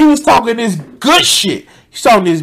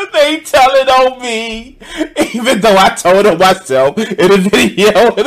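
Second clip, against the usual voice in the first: burst of laughter; 5 semitones higher than usual. Real, not acted. Streamer laughing at the guest's complaint.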